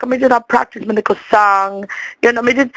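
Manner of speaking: normal talking voice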